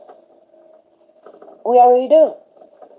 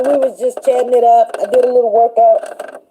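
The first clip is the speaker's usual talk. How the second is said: ordinary talk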